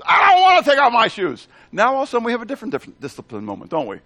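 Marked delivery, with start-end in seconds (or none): 0.0-1.5 put-on loud high whiny child shout; a kid yelling no at the door; bratty child refusing, shouted high; whining child. Child refusing in acted scene.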